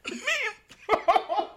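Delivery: laughing through it; amused laughing disbelief. Real, not acted. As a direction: crack up while saying it. Laughing while retelling.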